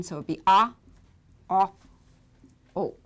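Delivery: clipped short ah, ah, o said loud; short vowel demo. Shown as a demonstration. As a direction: snap out short ah, ah, o. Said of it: "but with the shortened version".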